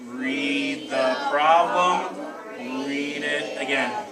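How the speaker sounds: drawn out very slowly, word by word; really slow. Shown as a demonstration. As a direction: stretch every word out. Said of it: "Let's say it really slow".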